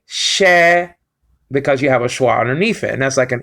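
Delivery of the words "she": "she" drawn out slowly as one syllable; demonstrated syllable. Shown as a demonstration. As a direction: sound out "she" slowly for a learner. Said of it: "So you just say".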